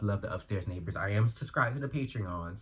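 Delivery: ordinary delivery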